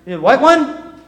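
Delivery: high, loud startled question; startled wife. Retold or imitated. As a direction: gasp it out in alarm. Wife startled by wrong pill.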